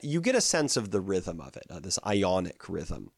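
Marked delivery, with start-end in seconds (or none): none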